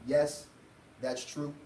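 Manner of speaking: ordinary delivery, nothing marked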